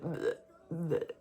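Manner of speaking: imitated vomiting noises; mock retching. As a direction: fake a retching gag.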